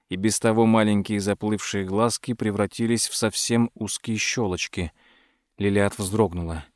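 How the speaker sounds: ordinary delivery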